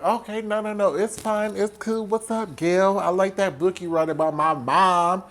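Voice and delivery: high, casual put-on friendliness; fake niceness. Sidney to the reporter, mock-friendly.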